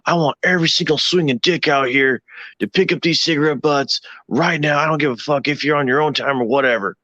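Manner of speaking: mock a third class dressing down the smokers; tearing into everyone. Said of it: "started tearing into everybody's ass".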